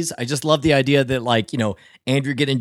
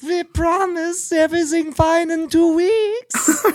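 high, drawn-out cartoon German accent; slow, high-pitched comic German accent; mock German accent; 14 semitones higher, slower than usual. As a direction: promise slyly in a silly German voice. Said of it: "they talk like this".

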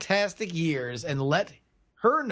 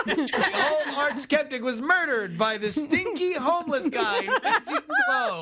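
high-pitched mock murder-mystery announcer; hammed-up movie trailer read, slowing on the title; crazy knockoff Clue promo; 7 semitones higher than usual. Pitching a Mexican knockoff of Clue, hammed-up announcer. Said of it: "really crazy Mexican knockoff version of Clue".

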